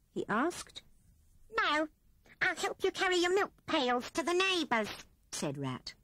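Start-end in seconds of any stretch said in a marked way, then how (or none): 1.6-4.9 a hint of eager squeak; lightly voiced Rat. A narrator's reading. Rat eagerly offering help to Hedgehog, lightly voiced. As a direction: offer help a little higher.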